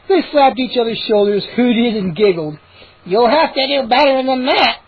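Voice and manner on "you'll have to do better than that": mocking higher gnome voice; smug little gnome jeering; grinning gnome. A narrator's reading. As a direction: taunt them with a grin. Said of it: "Hap grinned".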